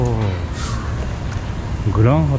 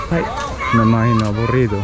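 usual speaking voice

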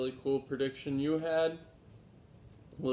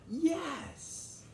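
a much higher squeal of yes; excited yes. Acted. The children answer Grandfather together, squealing with excitement. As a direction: burst out as the thrilled children. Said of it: "Barely able to contain their excitement".

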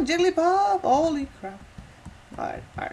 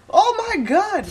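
shocked yell; 13 semitones higher, 10 dB louder, slower than usual. Real, not acted.

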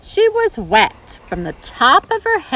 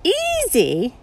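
crying out; 10 semitones higher than usual. Acted. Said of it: "cried a chameleon".

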